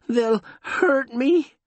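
high, slow, fearful voice; scared whimper; frightened. Acted. Frightened joker speaks.

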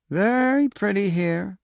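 slow, dreamy and faraway; dream voice. A narrator's reading. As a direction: say it as if half asleep. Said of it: "Pinchot said dreamily".

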